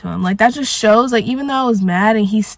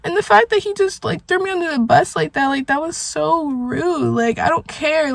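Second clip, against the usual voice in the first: higher, hurt voice near tears; real upset, pitched up and wounded; about to cry; 6 semitones higher than usual. Real, not acted. Venting that partner threw them under the bus, about to cry. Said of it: "I'm literally about to cry".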